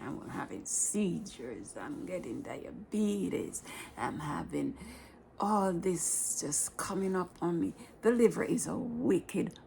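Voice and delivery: normal talking voice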